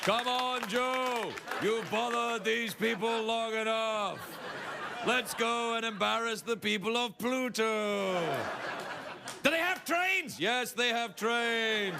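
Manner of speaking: high, slow comic alien. Acted. Aliens taking Joe Biden home, high comic voice. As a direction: put on a high, drawn-out alien voice.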